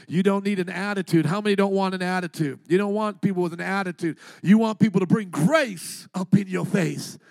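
loud, driving pulpit delivery; preaching at full force. Acted. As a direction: build it up for the congregation.

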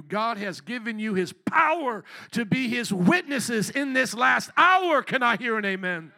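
preaching exhortation. Acted. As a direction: drive it home to the church. Rising exhortation to congregation.

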